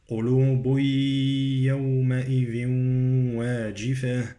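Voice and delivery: recited "قلوب" merging nasally into "يومئذ"; idgham of tanwin. Shown as a demonstration. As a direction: hold the humming y between "قلوب" and "يومئذ". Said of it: "تنوين يدغم في الياء".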